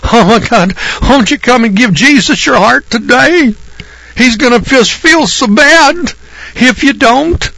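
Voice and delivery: high, pleading whine, slow at the end; whiny begging televangelist parody; mock whining preacher. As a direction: ham up a wheedling revival preacher. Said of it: "wheedle and whine, plead with you people".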